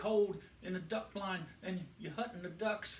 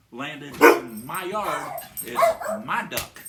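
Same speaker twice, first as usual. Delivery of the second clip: stern farmer; 10 dB louder than usual. Acted. Farmer scolding the city hunter, loud and stern. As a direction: boom it out like a big angry farmer. Farmer lays down the law loudly.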